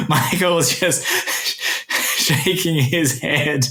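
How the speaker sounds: cracking up at his co-host; laughing. Real, not acted. Laughs at co-host's reaction.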